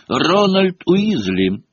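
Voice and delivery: drawn-out, high summons; high, stretched-out name call; muffled calling voice. A narrator's reading. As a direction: call the name slowly from upstairs. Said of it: "раздался сверху знакомый глуховатый голос".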